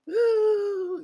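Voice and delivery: long, high, loud "woo"; swooning whoop. Retold or imitated. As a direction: let out a drawn-out admiring whoop. Retold swooning over the man.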